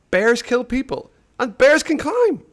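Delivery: high, loud panicked cry. Acted. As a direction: shriek it out in terror. Ivan panics at the bear.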